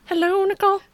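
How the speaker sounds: high falsetto greeting; falsetto impression. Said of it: "that's how they would talk in the show".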